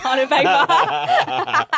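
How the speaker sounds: words bursting out through laughter; loud, high, drawn-out laugh on the words. Real, not acted. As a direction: crack up as you say it. Joking about coupons, both laughing.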